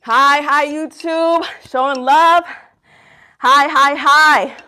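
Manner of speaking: loud, upbeat shout-out; excited greeting. Real, not acted. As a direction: call out happily to the online crowd. Greeting the online audience, hyped.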